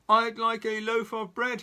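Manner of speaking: loud high boom. Acted. Mister Noisy booms at Mrs Crumb, loud. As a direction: boom across the bakery. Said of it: "he boomed".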